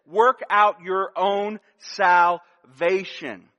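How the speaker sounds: slow, weighty scripture quote, stress on Work; deliberate text reading; slow emphatic reading. Shown as a demonstration. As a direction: lean on "Work" and read it slowly. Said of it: "and it's this".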